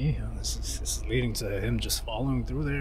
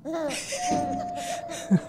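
high cackle. Real, not acted. Laughs after chute.

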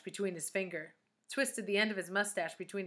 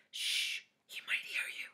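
urgent hushed whisper; shush, then whisper the warning; 4 dB quieter than usual. A narrator's reading. Bibi warning Calvin the teacher may hear, whispered.

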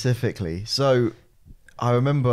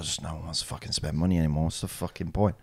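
gloomy driver; 7 semitones lower, 7 dB quieter, faster than usual. Retold or imitated. Driver voiced despairing about money.

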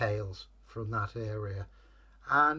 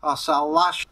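loud, raised, barked orders; forceful slave-driver shout; bossy captor; 7 semitones higher, 9 dB louder than usual. A narrator's reading. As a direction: drive the creature on with a loud threat. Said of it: "the guy said".

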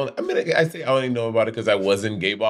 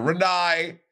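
Australian Renee; 5 semitones higher than usual. Retold or imitated. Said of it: "without being like".

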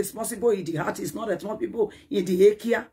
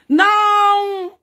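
sudden shout; 9 semitones higher, 8 dB louder than usual. Real, not acted. Rant, sudden outburst.

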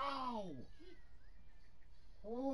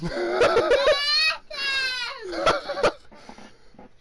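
loud, very high shrieking laughter; real helpless high-pitched cackle; uncontrollable laughing fit; 8 dB louder than usual. Real, not acted. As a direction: crack up completely, squealing. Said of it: "I have never laughed like that".

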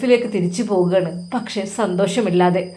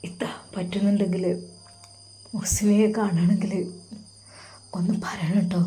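softer, choked plea; sobbing; 7 dB quieter than usual. A narrator's reading. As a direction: ask through held-back sobs. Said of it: "Sinan വിതുമ്പിക്കണ്ടാണ് അത് പറഞ്ഞത്".

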